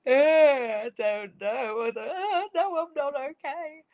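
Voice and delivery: put-on high, whimpering little kid voice; upset small child. Child comforted by mum, high and tearful. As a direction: sound like a toddler about to cry. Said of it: "that's when they".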